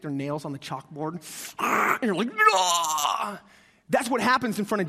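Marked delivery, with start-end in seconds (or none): 1.6-3.4 a short high far yelp of pain; mock screech. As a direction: cry out like nails on a chalkboard. Imitating nails on chalkboard.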